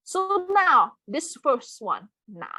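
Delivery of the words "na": "na" held low and dipping; third tone. Shown as a demonstration. Teacher points out first word, "na" in low third tone. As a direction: let "na" sink low in the third tone. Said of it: "third tone".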